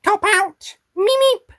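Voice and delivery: very high squeaky creature; Meep character voice. Acted. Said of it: "lines for beep, the meep".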